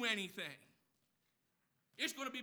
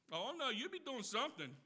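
normal talking voice

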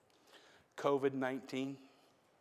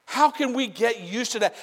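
higher and louder; preached louder; 10 semitones higher, 11 dB louder than usual. Real, not acted. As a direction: lift it into a cry. Repeat then louder.

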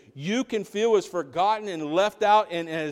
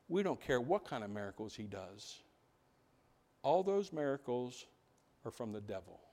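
low, much softer, measured refusal; cold dismissal; 4 semitones lower, 10 dB quieter than usual. Acted. Religious leaders dismissing the miracles, coldly quiet.

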